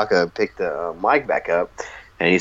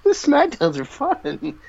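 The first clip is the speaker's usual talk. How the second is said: laughing; slower than usual. Real, not acted. Laughing after Kai and Tai.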